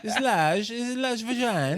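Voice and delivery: high, put-on Borat accent. Friend joins the joke in a Borat accent. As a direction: do Borat as a boat guide. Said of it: "Fucking Borat driving the boat".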